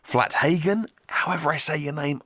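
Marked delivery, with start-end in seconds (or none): 0.1-0.9 Flathagen said as FLAT-HAY-gen, hard t, rising; higher, unsure Flathagen with a plain t before "hay"; alternative pronunciation attempt. Shown as a demonstration. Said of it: "I hope one of those is correct".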